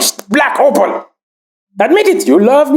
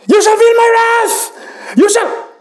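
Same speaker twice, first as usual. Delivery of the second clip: enraged boss roar; 8 semitones higher than usual. Acted. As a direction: roar the threat at the crowd. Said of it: "A little emotional".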